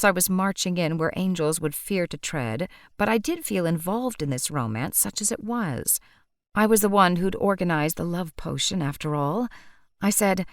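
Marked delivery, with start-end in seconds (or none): none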